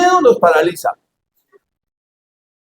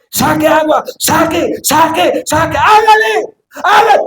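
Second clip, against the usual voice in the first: escalating mock command to bail; urgent shout; 8 semitones higher, slower than usual. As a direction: drive the crew to bail, rising to a yell. Urging disciples to bail water.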